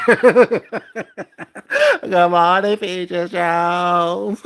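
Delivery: slow, drawn-out taunting hello; mocking sing-song greeting. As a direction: stretch it out to mock her.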